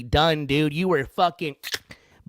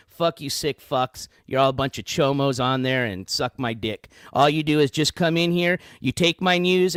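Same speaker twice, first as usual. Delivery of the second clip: normal talking voice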